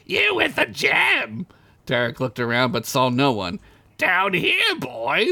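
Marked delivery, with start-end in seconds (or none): 0.1-1.4 high, slow, strange calling voice; creepy sewer creature. Acted. 4.0-5.3 creepy sewer creature. Acted.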